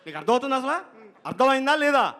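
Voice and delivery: high, fast, loud mock telling-off; scolding director. Director mock-scolds the assistant for saying five minutes, high and loud.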